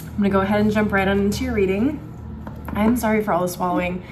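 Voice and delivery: ordinary talk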